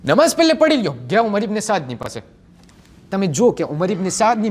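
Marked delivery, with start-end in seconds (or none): 0.0-1.0 urgent plea. Retold or imitated. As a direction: urge them to pray first, raising the voice. Companions plead to pray first.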